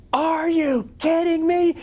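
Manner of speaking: high, slow, incredulous yell; exasperated. Retold or imitated. Reporter scolding the kid on camera, high incredulous. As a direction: stretch it out in disbelief. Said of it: "you can hear me going".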